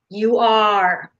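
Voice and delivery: ordinary talk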